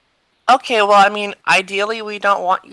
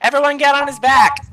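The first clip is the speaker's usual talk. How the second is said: high, raised call to the group; 7 semitones higher, 4 dB louder than usual. Acted. Rallying the party onto the bug, calling out high. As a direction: yell it as an order to everyone.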